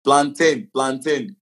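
high plantain as "plan-tin", falling at the end. Shown as a demonstration. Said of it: "How do you say?".

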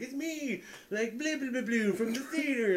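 lightly voiced woman reintroducing herself; chirpy imitation of an old coworker; high, light mock woman voice. Retold woman introducing herself.

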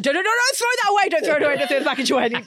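high, loud, fast frantic pleading; mock panicked dad. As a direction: play a dad urgently stopping his kid. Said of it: "and he's like".

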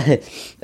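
audience bursts out laughing; group laughing together; crowd laughter. Real, not acted. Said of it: "pourquoi vous rigolez là?". (rires du public)

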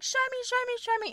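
very high, eager repeated plea; excited partner. Retold or imitated. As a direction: beg like a thrilled boyfriend. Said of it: "my partner was just like".

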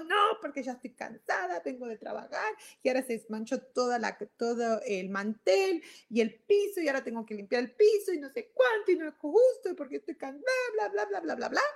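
tired mom snapping back at her husband; exasperated complaint. Acted. Stressed mother complaining to husband.

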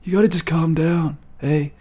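put-on a mild take on Bill Clinton soothing a kid; gently mock Clinton calming someone down; Bill Clinton impression. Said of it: "That's a very good Bill Clinton".

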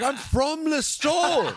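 very high, drawn-out wail; hammed-up outraged squeal; indignant protest. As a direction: protest it high, stretching LISTOWEL. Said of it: "And he's like".

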